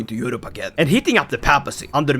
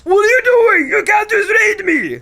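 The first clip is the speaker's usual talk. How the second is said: much higher, yelled protest; outraged raid victim; 13 semitones higher, 4 dB louder than usual. Acted. Raided ruler yelling at the raider, high and outraged. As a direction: squeal like an indignant victim.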